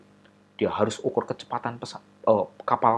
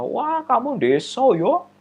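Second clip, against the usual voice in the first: mocking classmate; 12 semitones higher, 11 dB louder, slower than usual. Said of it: "teman-temannya ketawa-ketawa".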